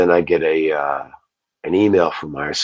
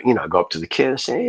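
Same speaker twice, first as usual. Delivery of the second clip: ordinary delivery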